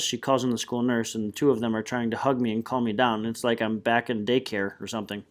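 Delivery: plain reading voice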